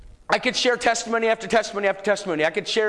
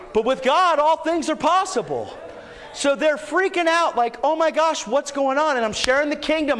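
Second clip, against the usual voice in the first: raised, higher-pitched preacher's voice; fervent preaching; 7 semitones higher than usual. Real, not acted. Preacher telling the church to heal and tell, fervent preaching. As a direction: preach it with mounting fervor.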